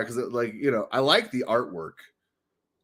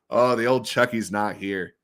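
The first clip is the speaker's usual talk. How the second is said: ordinary talk, nothing marked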